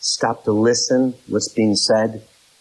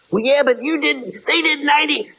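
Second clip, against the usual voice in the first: high squeaky blaming voice; squeaky mocking accuser; mock accusation. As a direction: whine the accusation like a petulant spouse. Mocking the blaming spouse again.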